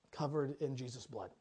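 low, soft, deadpan; dry irony. Real, not acted. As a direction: let the irony land flat. Ironic comment on the man.

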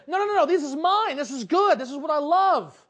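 loud, emphatic protest; insistent acted objection; defiant retort. Jonah's acted defiant retort to God.

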